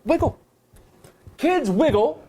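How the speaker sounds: chirpy student answer; excited. Retold or imitated. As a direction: pitch up eagerly.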